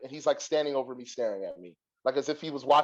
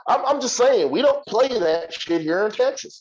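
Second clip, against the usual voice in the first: high, loud mock hillbilly protest; hillbilly scolding; 5 semitones higher, 4 dB louder than usual. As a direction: draw out the warning like an indignant hillbilly. Retold hillbilly telling them off.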